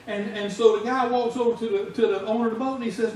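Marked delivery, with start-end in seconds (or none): none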